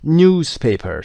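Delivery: newspaper with a yoo after the n, said slowly; British yoo sound. Shown as a demonstration. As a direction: say newspaper as nyoos-paper, drawn out. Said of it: "صدای یو داریم".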